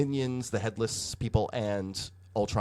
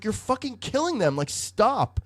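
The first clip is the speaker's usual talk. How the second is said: high-pitched appalled mock protest; Iron Man protesting; 11 semitones higher than usual. Iron Man pleading with Firestar to stop, appalled protest. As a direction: imitate someone urgently calling off a teammate.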